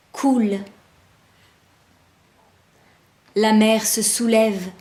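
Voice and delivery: slow, spaced-out poetry reading; haiku recitation. Acted. Poet presenting a haiku they composed, slow measured recitation. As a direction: let each short phrase stand alone between pauses.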